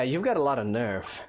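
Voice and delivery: ordinary talk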